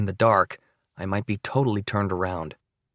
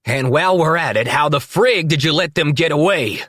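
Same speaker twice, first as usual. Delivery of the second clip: loud, high furious demand; pissed-off boss yelling; angry yelling; 7 semitones higher, 6 dB louder than usual. A narrator's reading. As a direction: bellow it at him in a rage. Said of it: "And he's pissed".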